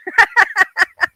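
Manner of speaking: high, loud burst of laughter. Real, not acted. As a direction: laugh out loud at your own remark. Amused by own remark.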